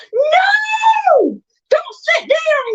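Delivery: high shrieking yell; little girl screaming. Retold or imitated. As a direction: scream to stop him in panic. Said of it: "I hollered at my".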